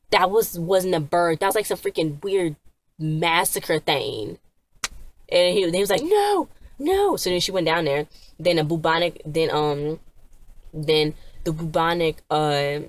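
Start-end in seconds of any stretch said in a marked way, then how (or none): 6.0-7.2 very high, strained cry of no; a high pleading no, no; panicked no. Retold or imitated. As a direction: squeal out a desperate no. Tommy pleads again.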